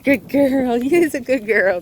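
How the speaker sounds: put-on happy baby-talk praise for a good girl; praising the dog. Shown as a demonstration. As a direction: show how you praise a dog that listened. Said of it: "I just praise 'em".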